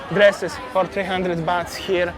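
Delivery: usual speaking voice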